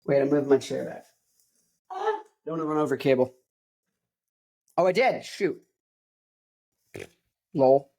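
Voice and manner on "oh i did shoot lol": ordinary delivery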